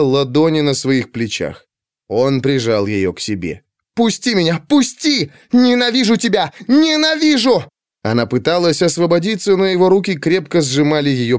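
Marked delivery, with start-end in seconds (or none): 3.9-7.6 high, desperate outcries, rising; hysterical protest. A narrator's reading. Pandora held tight by the servant, hysterical protest. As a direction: struggle free with frantic high cries.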